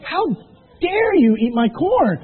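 high, loud, slow "how dare you"; hammed-up scolding of a raccoon; mock outrage. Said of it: "I was so mad".

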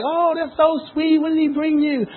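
high, drawn-out cooing imitation; mock-sweet high female chorus; gushing girlfriends. Girlfriends at work voiced, gushing.

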